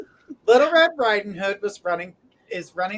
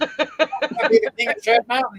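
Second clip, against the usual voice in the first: a lightly voiced mock royal boast, a bit higher; mock grand title; 5 semitones higher, 3 dB louder than usual. Host mocking her own pitch to the chat in a mock royal voice. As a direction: proclaim it like a pompous would-be king. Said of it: "I was saying".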